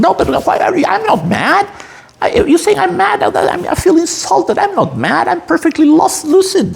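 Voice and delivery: high, insulted madman denying he's mad; mock madman protesting. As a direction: play a lunatic insisting he's sane, voice jumping up high. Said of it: "typical thing, you know, of a mad person".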